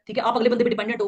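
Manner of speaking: ordinary delivery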